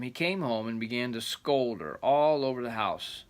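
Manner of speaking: storyteller reading aloud; rambling grandpa story. A narrator's reading. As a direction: read it like a grandpa's tale. Reading the folk tale aloud.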